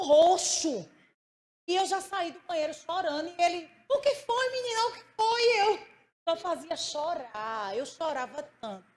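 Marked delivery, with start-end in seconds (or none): 3.9-5.6 high, urgent alarmed questioning; husband rushing to his crying wife, high and alarmed. Retold or imitated. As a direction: ask as a worried husband hearing her sob.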